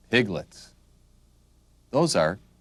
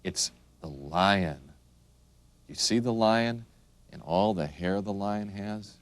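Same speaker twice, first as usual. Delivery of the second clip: ordinary delivery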